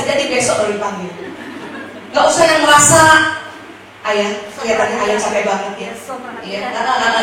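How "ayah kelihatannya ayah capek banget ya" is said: low, cooing mock concern; a bit over-the-top. Shown as a demonstration. Wife fussing over her tired husband, slightly over-the-top. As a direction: lay the tender sympathy on slightly thick. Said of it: "agak lebay gitu".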